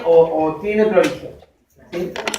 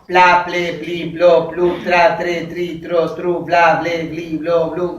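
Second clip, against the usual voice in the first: even, monotone singsong list; syllable drill recitation; 3 semitones lower, slower than usual. Shown as a demonstration. Teacher listing onset clusters for students, evenly recited. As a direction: rattle off the syllables in a steady rhythm.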